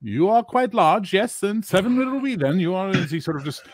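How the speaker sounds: high amazed barkeep; fussy innkeeper. Acted. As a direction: gush over the big guest. Innkeeper marvels at big guest.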